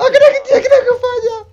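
fast, high laughing shout. Real, not acted. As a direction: blurt it out through laughter. Laughing hard at the situation.

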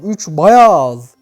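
ordinary talk

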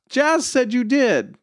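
put-on very high, drawn-out little-kid voice; high singsong little girl; tattling six-year-old. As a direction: tattle slowly in a small child's high pitch.

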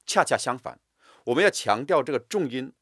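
usual speaking voice

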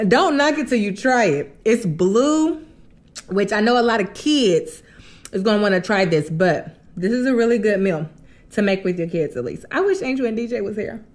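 talking between bites; while eating noodles. Real, not acted. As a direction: fork held at lips. Seen 2: forkful of blue noodles held at mouth, talking between bites.

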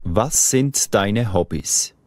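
ordinary talk, nothing marked

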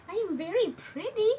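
put-on high baby voice; voicing the baby. Speaking as the pretty baby in high baby voice.

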